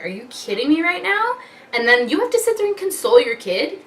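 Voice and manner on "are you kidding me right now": high, disbelieving outburst; incredulous. Retold or imitated. Mom stunned after the headbutt, incredulous. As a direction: jump up in pitch, stunned. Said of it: "I was like".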